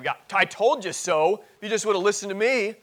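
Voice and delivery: loud, high-pitched I-told-you-so scolding; mock nagging. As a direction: act out a nagging wife at full volume. Imagined wife scolding him afterwards.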